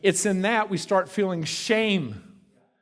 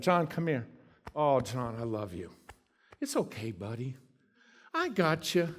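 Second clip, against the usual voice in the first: much softer and lower, loving; tender Jesus; 5 semitones lower, 6 dB quieter than usual. Acted. Jesus tenderly comforting John the Beloved, soft and low. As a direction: hold a beloved friend.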